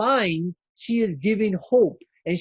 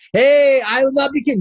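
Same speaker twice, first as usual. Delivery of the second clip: raised, higher defiant voice; loud defiant quote; 7 semitones higher, 7 dB louder than usual. Retold or imitated. Preacher voicing a despairing patient, loud and higher. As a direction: call it out loud and high.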